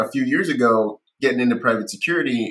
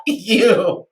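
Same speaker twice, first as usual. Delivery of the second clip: mock insult; 11 semitones higher, 7 dB louder, much slower than usual. His retort to recruiter.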